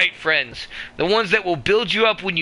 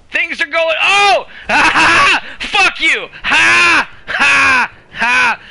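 taunting laugh; 10 semitones higher, 8 dB louder, much slower than usual. Real, not acted. Taunting laugh after a hit.

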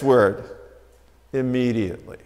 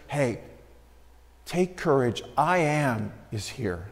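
hushed reassurance; 4 semitones lower, 8 dB quieter than usual. Acted. Jesus voiced softly, reassuring.

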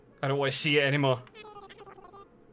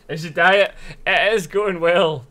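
louder, slow, mock-proud claim; 5 semitones higher, 12 dB louder than usual. Real, not acted. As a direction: oversell it sarcastically. Sarcastic loud mock claim.

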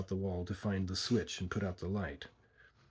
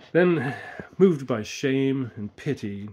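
ordinary delivery, nothing marked

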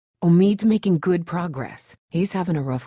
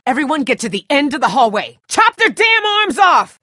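frantic shout; 11 semitones higher, 9 dB louder than usual. Acted. Survivor ordering everyone down the hallway, frantic shout. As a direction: bellow the orders in a panic. Retreating from zombie attack.

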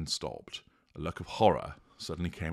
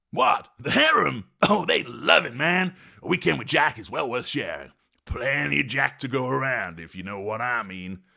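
high, brash bro voice, much louder; cocky party dude; 7 semitones higher, 5 dB louder than usual. Acted. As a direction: boast like a grinning playboy.

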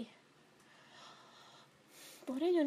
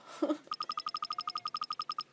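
squealing high-pitched laugh; real amused squeal; 5 dB quieter than usual. Real, not acted. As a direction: burst into a shrill giggle. Laughs after insulting the character.

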